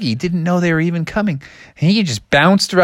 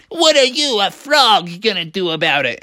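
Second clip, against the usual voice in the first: high, scoffing cartoon ogre; mocking ogre; 4 semitones higher than usual. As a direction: jeer at the little frog. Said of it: "the ogre just laughed and said".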